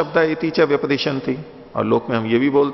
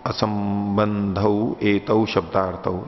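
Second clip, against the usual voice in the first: slow recitation; 3 semitones lower, much slower than usual. Shown as a demonstration. Reciting Sanskrit text slowly.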